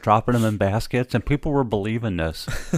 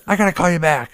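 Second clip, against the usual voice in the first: flustered; 9 semitones higher, 6 dB louder than usual. Retold or imitated. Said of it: "I'm going".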